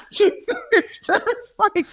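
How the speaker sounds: high, slow words through laughter; laughing. Real, not acted. Telling friend about Titanic quote, laughing.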